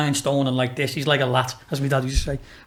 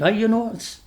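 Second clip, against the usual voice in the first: slow, higher-pitched Geordie teacher; badly imitated Geordie accent; 5 semitones higher, much slower than usual. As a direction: do a poor Geordie impression, drawing it out. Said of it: "I'm shit at a Geordie accent".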